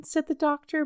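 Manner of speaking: ordinary delivery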